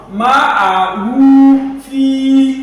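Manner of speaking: very slow, high chanted "ma'ahu fi"; stretched vowels. Shown as a demonstration. Teacher demonstrating waw sagira, "ma'ahu fi" stretched. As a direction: hold "hu" and "fi" on level notes. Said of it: "you are going to stretch it".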